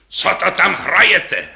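high, loud conductor's yell; bellowing. A narrator's reading. Conductor to players at the interval, bellowing. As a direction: roar it out, stretching the words. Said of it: "řval na ně dirigent".

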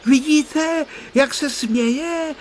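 put-on excited boy calling out high; excited cry. A narrator's reading. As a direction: cry out excitedly, drawing out the last words. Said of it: "vykřikl vzrušeně Honzík".

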